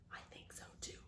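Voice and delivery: breathy whisper aside. Acted. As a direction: whisper it as a secret. Aside to audience.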